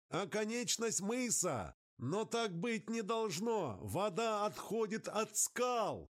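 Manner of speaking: high, urgent alarm over the static; alarmed radio voice. Acted. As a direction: cry out in disbelief.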